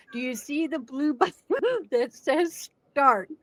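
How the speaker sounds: deadpan question, a laugh slipping through; straight face. Retold or imitated. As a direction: keep it dead serious while fighting a giggle. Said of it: "I said this with a straight face".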